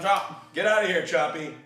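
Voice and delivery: ordinary talk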